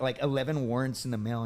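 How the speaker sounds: usual speaking voice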